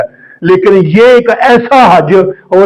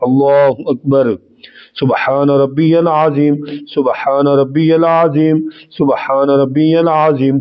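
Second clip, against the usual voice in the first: prayer recitation demo; 3 semitones lower, 5 dB quieter than usual. Shown as a demonstration. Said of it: "practical طریقہ بالکل آسان".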